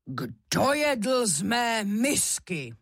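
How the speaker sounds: slow, strong papa bear voice; big bear demanding, drawn out and forceful; bear's roar. A narrator's reading. Papa bear finding his bowl used, roaring slowly. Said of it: "zařval silným medvědím hlasem".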